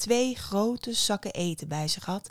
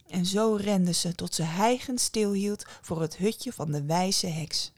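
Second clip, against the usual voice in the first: ordinary delivery, nothing marked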